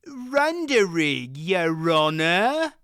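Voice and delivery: slow, dull mumble; dim-witted mumble. A narrator's reading. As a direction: play it vacant and stupid. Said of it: "he mumbled".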